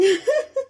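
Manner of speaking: squeaky wheezing laugh; high squealing laugh. Real, not acted. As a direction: let out a shrill giggle. Cracking up.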